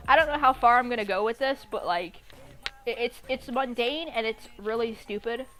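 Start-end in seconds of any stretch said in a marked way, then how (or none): none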